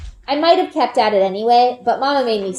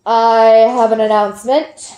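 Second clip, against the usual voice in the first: grave, louder announcement; a village elder's grave pronouncement; 6 dB louder than usual. A narrator's reading. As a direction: announce it with solemn weight. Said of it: "says gravely".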